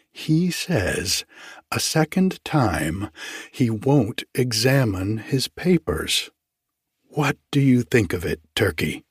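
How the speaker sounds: usual speaking voice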